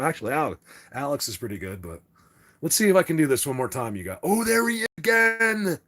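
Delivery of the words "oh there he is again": high, drawn-out cry of amazement; excited discovery. Real, not acted. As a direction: call it out, stretching the last word. Spots the object once more.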